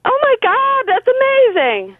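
high, excited disbelief; thrilled squeal. Real, not acted. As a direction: squeal with real joy. Radio ticket win, thrilled.

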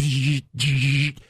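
two harsh zaps of a frying brain; imitated brain buzz. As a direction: buzz like a short-circuiting brain. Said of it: "The brain start going".

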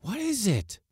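high alarmed question; cry. A narrator's reading. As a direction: call out in sudden alarm. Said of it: "cried the mate".

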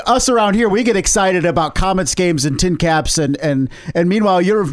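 usual speaking voice

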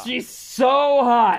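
high, stretched-out "so hot"; emphatic gushing. Real, not acted. Host tells co-hosts about the girl, emphatic gushing. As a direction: draw out "so" at a high pitch, really gushing. Gushing over the actress again.

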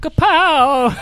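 high, punchy cartoon superhero cry; hammed-up fight sound effect; comic-book exclamation. Batman landing a comic punch, high hammed-up cry.

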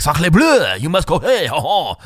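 hammed-up French exclaiming; French accent. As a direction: imitate an outraged French policeman, overdone. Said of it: "I'm over-exaggerating his accent".

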